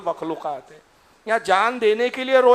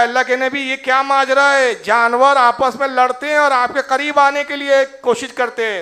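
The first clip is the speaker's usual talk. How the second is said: raised, louder voice of people marvelling; amazed question; 5 semitones higher, 7 dB louder than usual. Acted. Companions marvel aloud to the Prophet, amazed and loud. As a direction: ask in wonder, voice lifted high.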